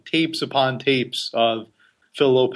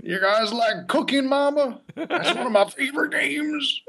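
Phil Lopez impression; 12 semitones higher than usual. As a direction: drag it out like the goofy buddy. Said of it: "So here's Phil Lopez".

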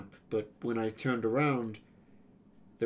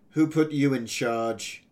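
loud, sarcastic challenge; sarcastic and louder; 3 semitones higher, 7 dB louder than usual. A narrator's reading. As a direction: throw the question out with sarcasm. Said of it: "I asked sarcastically".

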